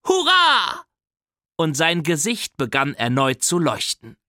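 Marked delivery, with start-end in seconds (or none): 0.1-0.8 hoher, lauter Jubelruf eines Kindes; jauchzend. Acted. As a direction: voller Freude hoch hinausjauchzen. (der kleine Bruder jauchzt hoch und laut vor Freude)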